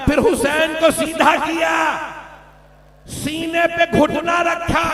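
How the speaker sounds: anguished keening shout of grief; wailing lament. Acted. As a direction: wail it to the grandfather as if seeing it now. Zainab laments Hussain's killing.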